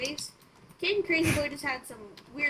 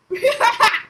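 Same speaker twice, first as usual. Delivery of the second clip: laughing; 10 semitones higher, 15 dB louder than usual. Real, not acted. Laughs at horse.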